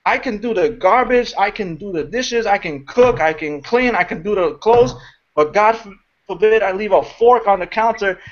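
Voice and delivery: ordinary talk, nothing marked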